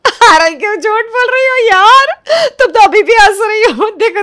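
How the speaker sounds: amused teasing. Acted. Sister-in-law teases with laughter.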